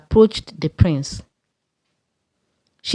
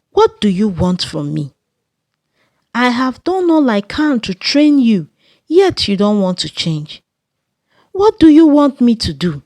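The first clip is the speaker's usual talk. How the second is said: screaming at daughter; 3 semitones higher than usual. Acted. Mother scolds her daughter in the market, screaming. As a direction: berate the ungrateful child in public. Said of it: "began screaming at her".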